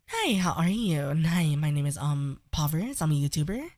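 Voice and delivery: put-on soft, lilting girly introduction; softly put-on feminine YouTuber voice; feminine voice demo. Shown as a demonstration. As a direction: introduce yourself in a gentle feminine voice. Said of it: "play with your pitch".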